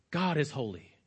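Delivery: low, soft statement of a solemn truth; understated statement. Shown as a demonstration. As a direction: say it softly and low, without emphasis. States the message, then repeats it weightier.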